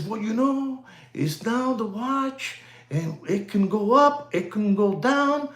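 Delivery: soft, Popeye-like put-on voice; mock sexy voice. Imitating the guy on the watch market, softly mock-sexy. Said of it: "He talks like that".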